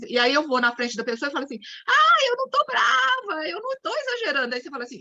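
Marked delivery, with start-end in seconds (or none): 1.9-4.5 exaggerated denial. Shown as a demonstration. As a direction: ham up the angry woman who says she's fine. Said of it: "quando eu exagero". (negação brava exagerada, bem aguda)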